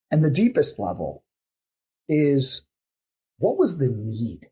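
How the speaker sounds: ordinary talk, nothing marked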